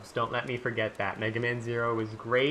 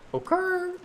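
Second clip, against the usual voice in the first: high squeak; 3 dB louder than usual. Shown as a demonstration. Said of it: "I don't know what occurred".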